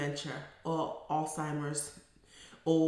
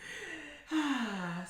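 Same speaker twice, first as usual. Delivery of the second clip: long letting-go sigh; audible sigh; 7 semitones higher, 3 dB quieter than usual. Real, not acted. Therapist winding down after her story, long audible sigh. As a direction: sigh out before signing off.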